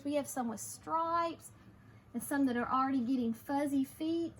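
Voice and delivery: usual speaking voice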